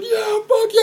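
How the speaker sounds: very high, squealed whooping; retold crowd cheer. Crowd carrying the stage diver around, wildly cheering. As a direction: imitate a crowd going nuts. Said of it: "they're, like, freaking out".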